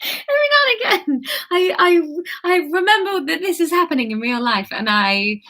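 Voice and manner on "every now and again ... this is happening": squealing high laughter; laughing. Real, not acted. Reader giggling at her failed accent, laughing. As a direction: laugh it out, much higher.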